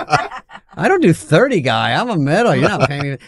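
high, slow, hammed-up indignant rant; mock outrage. Comic imitating his younger self outraged at doing thirty, high and slow. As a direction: play the offended middle act. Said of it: "I go".

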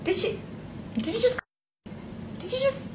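soft, high disbelieving double-take; can't believe what you just heard; quiet stunned "did you just" with a high pitch; incredulous. Real, not acted.

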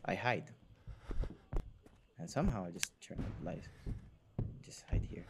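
hushed whisper while hiding. Real, not acted. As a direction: whisper so he can't hear. Hiding from killer in game.

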